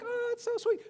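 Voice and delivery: high, soft sentimental coo; mock gushing. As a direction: coo it like a smitten onlooker. Mimics people gushing over love.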